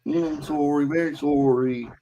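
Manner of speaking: high, hammed-up Canadian apology; apologetic Canadian accent. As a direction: drag out a mock Canadian sorry.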